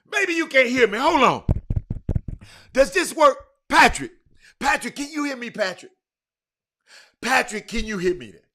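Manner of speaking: calling out. Real, not acted. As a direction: call out to someone who may not hear. Checking if a caller hears.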